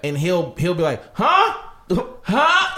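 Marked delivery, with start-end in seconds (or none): 1.1-2.7 high, loud questioning "huh?"; mock hard of hearing. Granddad stonewalling a telemarketer on the phone, loudly playing deaf. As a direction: pretend you can't hear the caller. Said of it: "He'll get louder".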